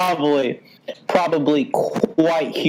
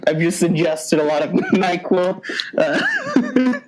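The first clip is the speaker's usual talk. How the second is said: laughing through words; 2 semitones higher than usual. Real, not acted. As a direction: try to talk but keep cracking up. Laughing while claiming NyQuil.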